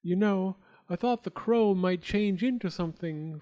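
ordinary delivery

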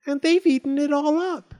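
loud, very high squeaky wail; crying Baby Bear. A narrator's reading. Baby Bear over his empty bowl, squeaky cry. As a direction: wail it in a tiny cub voice. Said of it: "he cried".

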